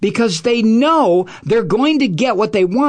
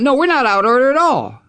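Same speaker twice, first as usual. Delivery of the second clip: Kamala Harris; 6 semitones higher, faster than usual. Retold or imitated. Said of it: "who wants to point out".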